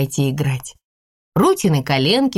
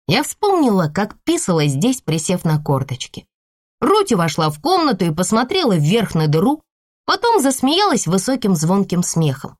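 usual speaking voice

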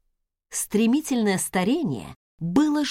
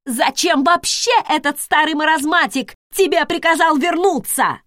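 loud, high, hateful tirade; furious ranting; 7 semitones higher, 6 dB louder than usual. Acted. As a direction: pour out years of hatred, yelling. Furious hateful rant at cousin.

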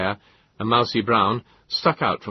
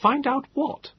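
put-on pompous young man; slower than usual.

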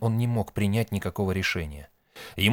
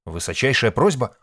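loud, raised question; puzzled echo; 4 semitones higher, 6 dB louder than usual. A narrator's reading. Nik hearing the term and asking, puzzled. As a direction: repeat it quicker, as a startled question.

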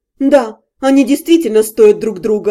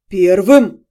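low, stunned gasp of a word; a man caught off guard, low and breathy; surprised exhale; 6 semitones lower than usual. A narrator's reading. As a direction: breathe it out in disbelief. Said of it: "удивлённо выдохнул Дальшер".

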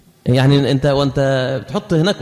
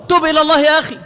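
urgent plea; 10 semitones higher, faster than usual. Real, not acted. Preacher urging listener to repent.

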